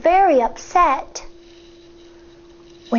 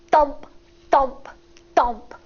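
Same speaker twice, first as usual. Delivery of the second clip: usual speaking voice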